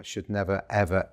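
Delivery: ordinary talk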